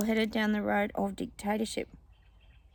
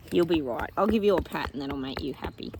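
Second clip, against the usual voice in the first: ordinary delivery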